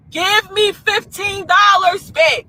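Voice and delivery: high, drawn-out retold demand; slow, emphatic, indignant shout; angry demand. Demanding her change in retold row.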